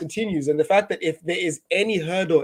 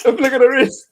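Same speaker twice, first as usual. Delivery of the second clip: high playful voice; 9 semitones higher, 9 dB louder than usual. Pretend. Joking punchline, playful voice.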